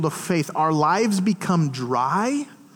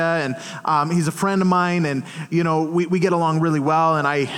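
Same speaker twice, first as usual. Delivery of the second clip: normal talking voice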